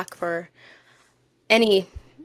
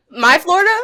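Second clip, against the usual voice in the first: mock alarm; 8 semitones higher, 8 dB louder, faster than usual. Retelling alarm at Florida episode.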